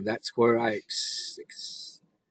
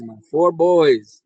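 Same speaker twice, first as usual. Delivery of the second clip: ordinary talk, nothing marked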